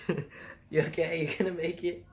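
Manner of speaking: giggling through it, pitch up; laughing through the quote. Retold or imitated. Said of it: "It's, "Are".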